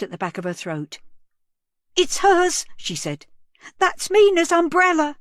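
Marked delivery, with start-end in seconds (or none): 1.9-2.6 high, loud, stricken cry of recognition; anguished cry. A narrator's reading. Mother recognising her daughter's umbrella, anguished cry. 3.8-5.2 high, loud, stricken cry of recognition; anguished cry. A narrator's reading. Mother recognising her daughter's umbrella, anguished cry.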